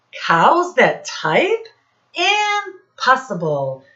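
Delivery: a farmer who can't believe it; stunned disbelief. Acted. Farmer Brown reacts to typing cows.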